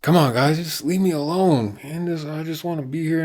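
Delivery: high whiny mix of anger and hurt; hammed-up upset kid pleading to be left alone; angry sadness. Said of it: "more anger mixed in his sadness".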